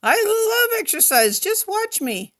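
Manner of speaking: high, loud, over-eager boast; eager cry. Acted. Frightened little elephant insisting to the tiger he loves exercise. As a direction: sell it fast to escape being eaten. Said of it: "cried Sookie".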